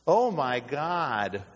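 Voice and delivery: drawn-out mocking exclamation; slow, exaggerated imitation of slang. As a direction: stretch it out like a casual gasp of surprise. Mimics casual misuse of God's name.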